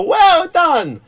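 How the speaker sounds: high, loud sing-song well done; hammed-up praise for a child; praising parent. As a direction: cheer a toddler on brightly. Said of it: "you go".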